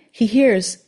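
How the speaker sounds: normal talking voice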